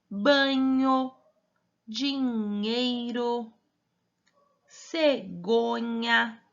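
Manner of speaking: each word stretched out, pause between words; slow reading drill. Shown as a demonstration. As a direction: read the list slowly, lingering on every syllable. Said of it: "como que a gente faz a leitura dessa sílaba". (leitura bem arrastada, sílaba por sílaba)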